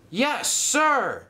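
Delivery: high, rousing reply; cheer. Acted. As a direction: cheer it out as a keen crew. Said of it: "cheered the engines".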